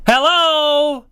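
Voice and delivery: high, drawn-out incredulous call; exasperated. Real, not acted. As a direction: call it out as if nobody is home.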